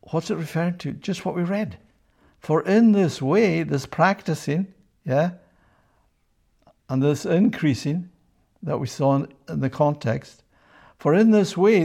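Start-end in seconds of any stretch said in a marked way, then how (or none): 2.4-3.6 "For in this way" loud and slowed, each word weighted; bold emphasis. Shown as a demonstration. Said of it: "I put that in bold". 11.0-11.8 much higher and louder on "For in this way"; emphatic proclamation. Acted. Rereads verse climax with full force.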